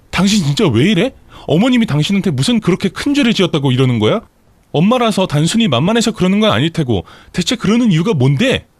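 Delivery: high, indignant demand; angry shouting. A narrator's reading. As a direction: confront the husband, demanding an answer. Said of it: "라면서 소리쳤더니".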